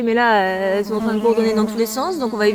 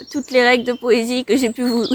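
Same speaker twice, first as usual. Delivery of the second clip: ordinary talk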